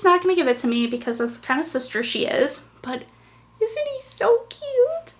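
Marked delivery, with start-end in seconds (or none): 3.6-5.1 high, slow cooing voice; baby talk. Acted. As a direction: coo over the cute dog. Cooing over toy dog.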